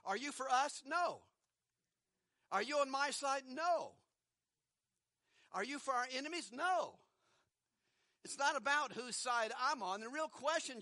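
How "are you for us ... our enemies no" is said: "No." louder and higher each time; emphatic no. Shown as a demonstration. Preacher acting out the angel's blunt answer, emphatic. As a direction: snap back each "No." harder. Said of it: "That just stop for a moment".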